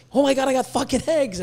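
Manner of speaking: mock a new breeder's squeal over eggs; excited breeder.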